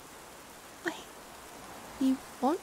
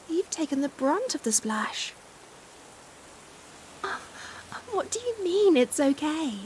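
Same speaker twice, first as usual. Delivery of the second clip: ordinary talk, nothing marked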